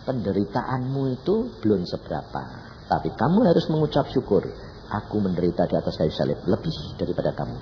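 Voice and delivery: lightly voiced God, measured and low; understated divine reply; God speaking. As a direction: answer as God, in a restrained low voice. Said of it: "Tuhan yang ngomong".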